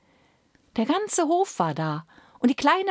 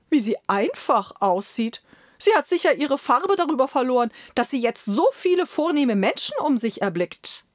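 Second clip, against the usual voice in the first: higher, haughty court steward; pompous steward; 5 semitones higher than usual. Acted. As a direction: sniff at the plain bird like a proud official. Steward voiced in story.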